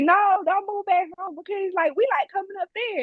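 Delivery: high, put-on voice of relatives asking her to stay; family begging her not to move home; imitating family pleading. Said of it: "And they was like".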